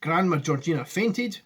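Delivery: ordinary delivery, nothing marked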